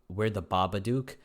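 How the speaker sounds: ordinary delivery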